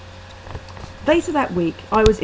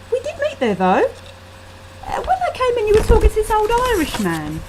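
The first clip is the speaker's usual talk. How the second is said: Sophie's voice; 12 semitones higher, 3 dB louder, faster than usual. A narrator's reading. Friend voiced in quarrel.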